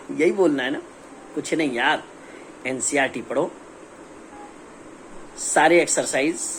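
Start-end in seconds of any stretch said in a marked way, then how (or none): none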